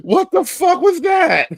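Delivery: high, loud, stunned outburst; shocked shout. Pretend. Reacting to the clip, a high shocked shout. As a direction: blurt it out in disbelief, slowly.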